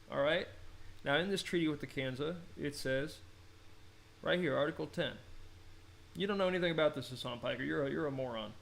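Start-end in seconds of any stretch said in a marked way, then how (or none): none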